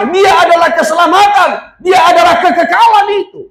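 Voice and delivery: high, urgent pulpit proclamation; fervent preaching. Real, not acted. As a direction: proclaim it to the congregation with full force. Preaches at full force.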